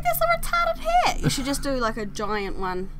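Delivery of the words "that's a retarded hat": very high mimicked scorn; mocking imitation. Imitating partner laughing at hats, high mocking voice.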